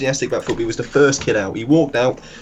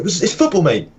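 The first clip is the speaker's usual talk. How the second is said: much higher, selling the lie; high mock sincere; 8 semitones higher than usual. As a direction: pitch it up, fake keen. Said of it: "We go".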